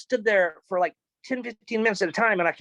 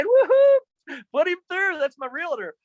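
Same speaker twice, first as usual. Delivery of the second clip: joyful whoop; 10 semitones higher, 4 dB louder, slower than usual. Real, not acted. Secretary announces realtor call.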